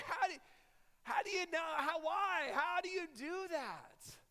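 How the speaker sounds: high, loud incredulous outburst; astonished. Retold or imitated. Young traveler questioning the daring missionary, acted loud astonishment. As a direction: play up the bewildered disbelief. Acting his astonishment at her stories.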